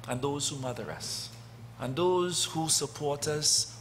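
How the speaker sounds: ordinary talk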